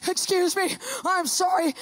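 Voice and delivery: high, sugary apology; put-on polite sweetness; trying to be nice. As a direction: apologize in a lifted, over-nice voice. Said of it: "trying to be nice".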